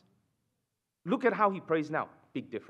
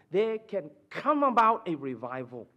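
usual speaking voice

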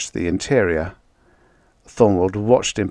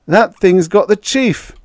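high, loud cry of alarm; alarmed shout; 12 semitones higher, 7 dB louder than usual. Acted. Guard seeing the chief grabbed by the neck, alarmed shout. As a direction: shout out in sudden panic. Guard sees boss being strangled.

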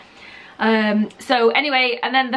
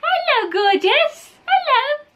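very high, slow, adoring coo; slower than usual. Real, not acted. Parent greets gorgeous baby, high slow baby talk.

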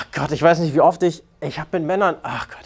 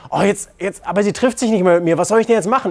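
imitating a flustered client, pitched higher; mock panicked client; 6 semitones higher, faster than usual. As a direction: stammer it like a guy in a mild panic. Imitates panicked client weeks later. (nachgeahmter Kunde, leicht panisch und hoch)

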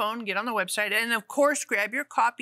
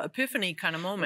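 ordinary talk

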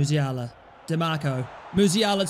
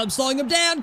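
ordinary delivery

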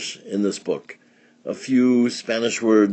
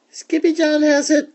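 very high little-sister voices; kitten sisters; 4 dB louder than usual. A narrator's reading. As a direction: squeak it as tattling sisters. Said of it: "said the sisters".

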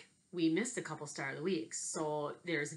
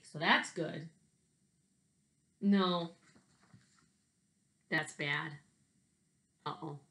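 lively picture-book read-aloud with big swings; reading to children; 4 dB louder, slower than usual. Acted. Teacher reading a picture book to her class, lively. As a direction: tell it like a story hour for kids.

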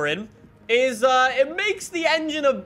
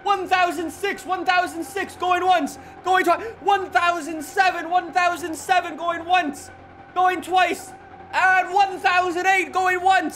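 fast rising auctioneer chant, higher; 6 semitones higher than usual. Pretend. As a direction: call the bids like an auctioneer. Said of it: "I feel like an auctioneer".

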